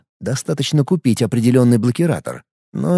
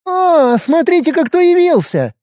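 delighted; 3 dB louder than usual. Acted. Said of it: "восхитилась хаоситка".

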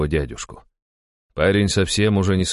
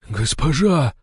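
high, overwhelmed exclamation; muttered in shock; 9 semitones higher, slower than usual. A narrator's reading. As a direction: murmur it in disbelief. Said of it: "пробормотал он". (потрясённо пробормотать)

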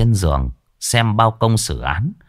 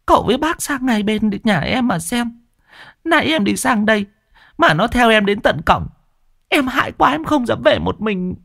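much higher, whining village woman; pleading woman; 13 semitones higher than usual. Acted. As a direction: plead for help, high and whiny.

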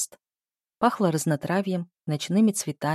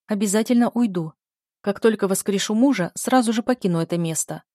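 usual speaking voice

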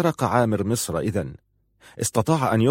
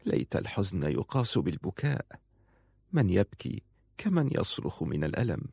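humble consoling; 8 dB quieter than usual. A narrator's reading. Bowed woman apologises to her grieving lord, hushed and tender. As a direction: plead quietly, with sorrowful tenderness.

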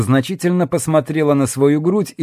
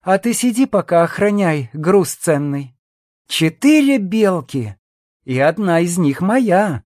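snide; 6 semitones higher than usual. A narrator's reading. Woman leaving Jackson to guard the cargo, snide. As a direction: rub it in with a sly smile. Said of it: "ехидно улыбаясь, ответила стерва".

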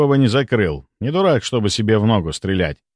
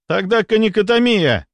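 high-pitched, determined exclamation; urgent counter-proposal; 10 semitones higher, 3 dB louder than usual. A narrator's reading. A doctor proposes cutting the airway open, urgently. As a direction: insist with pitch way up.

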